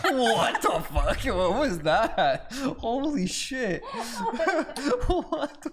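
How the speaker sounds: high, slow, laughing shock; cracking up in high-pitched astonishment; laughing disbelief. Real, not acted. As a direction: laugh through stunned disbelief. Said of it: "that was horrendous".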